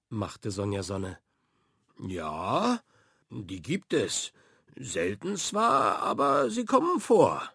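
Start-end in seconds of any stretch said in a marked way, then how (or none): 2.0-7.4 a lightly voiced sheepish pirate; Captain Frank. A narrator's reading. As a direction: bluff your way through it.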